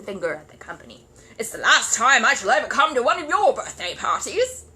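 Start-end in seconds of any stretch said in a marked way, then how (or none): 1.4-4.6 loud, high indignant huff; indignant parting shot; huffy. Acted. Curry to the company, loud indignant huff.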